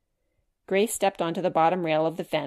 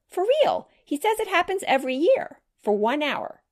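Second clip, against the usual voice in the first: surprised doubt; 10 semitones higher, 4 dB louder than usual. Acted. Sam doubting the midnight story.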